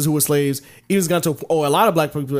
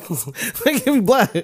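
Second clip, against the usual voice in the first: laughing, drawn out; 5 semitones higher, slower than usual. Real, not acted. Repeats the protest laughing, slower.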